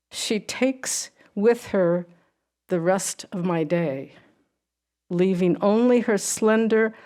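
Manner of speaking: measured poetry reading with pauses; poem reading. Acted. Poet reading her seal poem to the audience, measured and unhurried. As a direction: let each image settle, unhurried.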